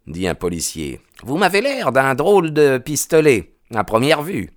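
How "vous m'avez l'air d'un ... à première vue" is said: policeman's voice pitched much higher, a bit faster; smooth over the stranger's anger; placating policeman. Acted. Policeman calming the angry stranger, high voiced.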